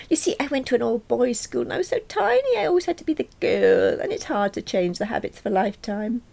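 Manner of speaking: high-pitched, earnest excuse; high, apologetic character voice; apologetic Nick. Acted. Voices Nick apologising to his partner.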